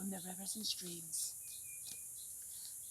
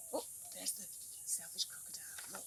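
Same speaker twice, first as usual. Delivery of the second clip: hushed whisper, almost no voice; 2 semitones lower, 8 dB quieter than usual. Acted. Teacher whispers to the children about the crocodile. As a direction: whisper as if the crocodile might hear.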